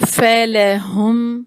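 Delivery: فعل ہم drawn out very slowly; slow recitation. Shown as a demonstration. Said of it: "ہم اس کو پڑھیں گے". (فعل ہم آہستہ)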